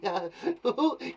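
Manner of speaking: put-on giggle; playful little giggling burst; demonstrated giggle. Shown as a demonstration. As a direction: giggle to show the word's sound. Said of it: "It kind of sounds like giggling".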